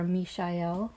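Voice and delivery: "Michael" said mee-shai-EL, with a sh sound; French-like pronunciation. Shown as a demonstration. Host offering another name for the archangel, "Michael" as mee-shai-EL. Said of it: "Michael or Michael or Michael".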